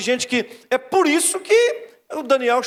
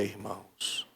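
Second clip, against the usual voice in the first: near whisper; 13 semitones lower, 16 dB quieter, much slower than usual. Shown as a demonstration. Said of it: "repeated call".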